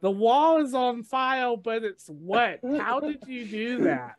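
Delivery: put-on squeaky exasperated raccoon yelling at a kid; high Rocket voice. Rocket scolding Groot, high exasperated voice.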